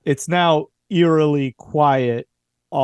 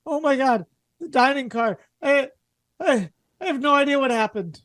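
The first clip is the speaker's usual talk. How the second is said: much higher, trembling with fear and shock; a passenger shaken by something awful; shaken and distraught; 12 semitones higher than usual. Acted. Shaken character behind the door.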